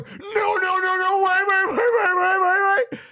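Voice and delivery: high loud pleading wait; desperate shout. Retold or imitated. Trying to stop the crowd singing, high desperate shouting. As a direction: yell to stop it in panic.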